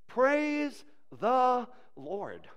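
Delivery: very slow, word by word; deliberate, drawn-out climax; solemn proclamation. Acted. Closing line of psalm.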